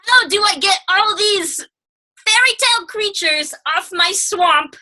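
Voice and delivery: fed-up ogre complaining about guests; hammed-up Shrek grumble, slow. Shrek voiced in retold scene.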